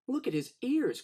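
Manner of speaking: high, mocking child's taunt; a boy's playful taunt; teasing boy. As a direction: jeer at the elephant's ears. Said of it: "cried one boy, teasing Dumbo".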